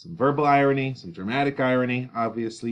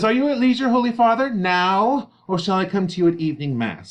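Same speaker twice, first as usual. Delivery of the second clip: high, loud, hurrying to get rid of Paris; impatient urgency; 11 semitones higher, 8 dB louder than usual. Acted. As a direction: press the friar to speed things up. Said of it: "can we please get to the point".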